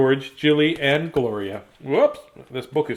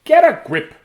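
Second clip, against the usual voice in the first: higher, louder brisk grandma order; grandma snapping; 11 semitones higher, 7 dB louder than usual. Acted. Grandma telling grumpy Grace off, loud mock scolding. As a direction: bark it like a fed-up granny. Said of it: "groans Grandma".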